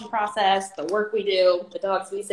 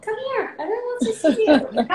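high, sing-song pet voice; talking to a dog; 4 dB louder than usual. Real, not acted. Calling the dog over.

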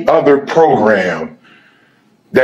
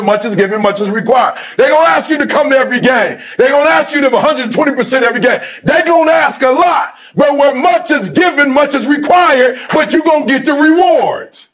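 booming, driving sermon cadence, higher; preaching at full force; 12 semitones higher than usual. Acted. Fired-up coach preaching to his players about rewards. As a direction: preach it to the team like a pulpit. Fires up the team.

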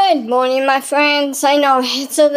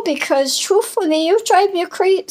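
normal talking voice

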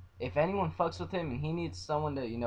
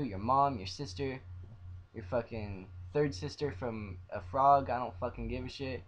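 ordinary talk, nothing marked

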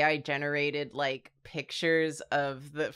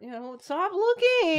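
higher, pleading stop looking; insecure character voice; 13 semitones higher, 4 dB louder than usual. Pretend. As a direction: beg them not to look.

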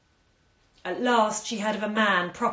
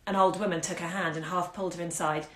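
plain reading voice

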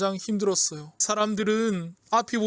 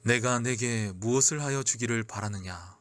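much lower, hushed voice of authority; Jesus; 10 semitones lower, 4 dB quieter than usual. A narrator's reading. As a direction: ask gently, deep and solemn. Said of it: "예수님의 따스한 음성은". (낮고 따스한 음성)

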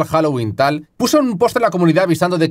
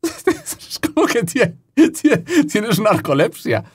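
much higher, slow, through laughter; laughing; 8 semitones higher, much slower than usual. Real, not acted. Joking about the character's narcolepsy, laughing. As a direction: giggle through the words.

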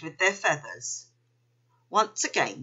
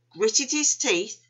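ordinary delivery, nothing marked

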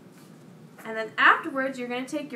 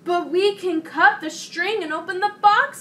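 lightly voiced higher toad; objecting toad; 5 semitones higher, 4 dB louder than usual. A narrator's reading. Toad countering Frog's tied-up box, objecting. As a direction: raise the pitch to point out a loophole.

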